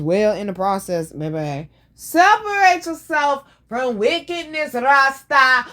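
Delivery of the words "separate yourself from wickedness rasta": high, slow Jamaican-style preaching; hammed-up Jamaican Rasta voice; mock Rasta preaching. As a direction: preach it like a Rasta elder, drawn out.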